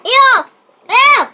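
loud high disgusted ew; disgusted yell. Real, not acted. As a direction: recoil from the taste, loud. Disgusted by jelly bean taste.

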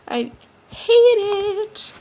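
laughing, high and slow. Real, not acted. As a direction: laugh through the complaint. Laughs at broken laptop.

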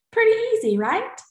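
high, loud, cheerful; upbeat. Real, not acted. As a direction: make it sound easy and fun. Teacher encouraging kids.

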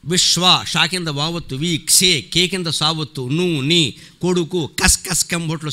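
విశ్వ spelt letter by letter, schoolroom style; spelling it out. Shown as a demonstration. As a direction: recite each syllable and its conjunct separately. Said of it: "భొట్లు అని పలకాలి".

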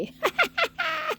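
very high, scornful cackle; mocking laugh. Real, not acted. Vlogger cackling with scorn at the woman she insults. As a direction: cackle in contempt.

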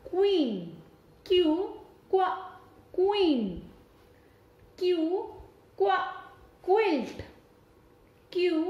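teacher drilling Q words; phonics word drill. Shown as a demonstration. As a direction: sound out क्यू, क्व, क्वीन for kids. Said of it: "जो इन्हीं letter से start होते हैं और उनकी sounds".

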